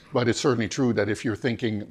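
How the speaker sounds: normal talking voice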